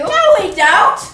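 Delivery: loud retort. Acted. Daughter contradicting her mother.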